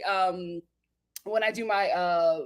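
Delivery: usual speaking voice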